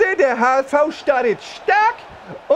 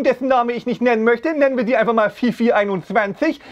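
usual speaking voice

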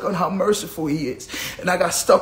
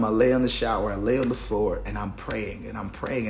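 much softer, lower and inward; hushed prayer memory; 8 semitones lower, 6 dB quieter than usual. Retold or imitated. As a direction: drop to a near hush, as if still on the floor praying. Describing lying on the floor praying.

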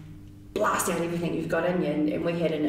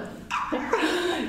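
laugh; 11 semitones higher than usual. Real, not acted. Laughs about eating chocolate.

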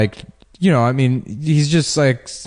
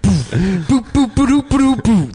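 high-pitched mock beatbox sounds; beatbox noises; 9 semitones higher than usual. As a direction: boop along like a dance track. Wheelchair guys' music noises.

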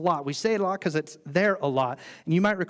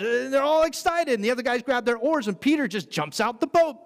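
high, louder, building excitement; excited telling; 7 semitones higher, 5 dB louder than usual. Acted. Preacher telling the miracle catch, high and excited. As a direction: tell it like you can't believe it.

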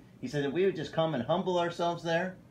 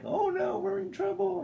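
mock panic, very high-pitched; hammed-up high worrier; stressed-out panic. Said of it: "they seem to be stressing out a lot".

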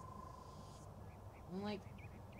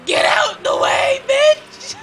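shouting; 14 semitones higher, 15 dB louder, much slower than usual. Retold or imitated. Yelling at reckless driver.

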